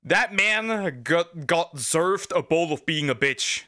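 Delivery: slow, stretched verdict; slow mock verdict; slow drawl. Real, not acted. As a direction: drag every word for effect.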